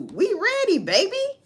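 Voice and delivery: high, loud cocky taunt; mock taunt. Russia taunting the United States, high hammed-up brag. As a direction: crow it like a bragging rival.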